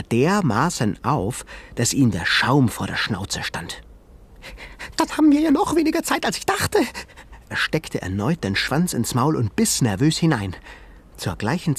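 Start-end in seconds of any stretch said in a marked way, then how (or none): 5.0-6.8 very high, fast little animal voice; high fast creature voice; high squeaky creature. Acted. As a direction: chatter like a nervous jumping shrew. Sengi alarmed.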